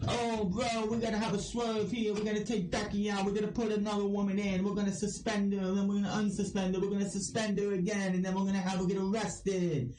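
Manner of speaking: high, run-on bad impression of a bro booker; terrible Vince Russo. Podcaster mocking a wrestling writer's plot twists, hammed-up bad impression. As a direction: pitch a silly storyline high and nonstop, badly imitated. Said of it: "that's my terrible Vince Russo impression".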